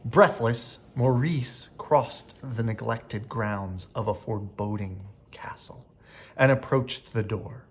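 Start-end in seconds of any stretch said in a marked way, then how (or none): 5.3-5.9 whisper. A narrator's reading. Spooky castle reveal.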